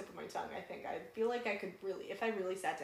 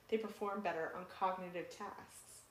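ordinary talk

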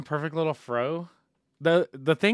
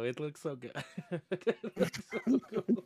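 laughing; 5 semitones higher, 4 dB quieter than usual. Real, not acted. Laughing about the Chia Pet.

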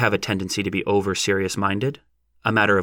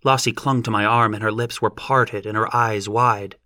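usual speaking voice

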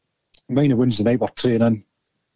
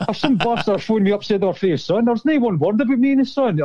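imitated outrage, pitch climbing sharply; raging; 8 semitones higher than usual. Prank victim on the team bus finding he's not in the paper, raging. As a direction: act out an indignant rant, voice going high. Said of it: "I absolutely raging".

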